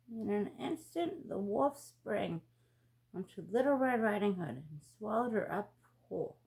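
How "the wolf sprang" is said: plain reading voice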